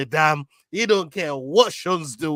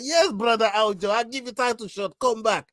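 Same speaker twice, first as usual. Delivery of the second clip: lightly put-on Georgian accent, higher; playful Georgian accent imitation, pitched up; 7 semitones higher than usual. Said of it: "Georgian accent didn't really marry up".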